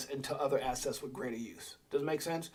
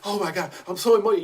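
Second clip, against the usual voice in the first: fast, louder, gushing parody; mock emotional gush; 4 semitones higher, 7 dB louder, faster than usual. Mocking a crypto holder in love with a coin, gushing fast. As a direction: ham up an overexcited fan. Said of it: "you get all full of".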